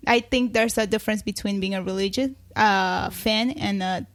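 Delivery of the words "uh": ordinary delivery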